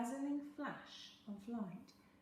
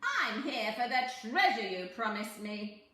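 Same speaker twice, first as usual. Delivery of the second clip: sly little man; 9 dB louder than usual. A narrator's reading. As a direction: bargain like a crafty imp.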